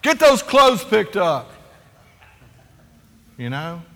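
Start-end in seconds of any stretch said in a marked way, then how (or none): 0.0-1.5 loud, stern parental command, drawn out; harsh order. Retold or imitated. Dad yelling at kids to tidy up, loud and stern. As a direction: bark it like an irritated dad. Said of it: "I'm just like".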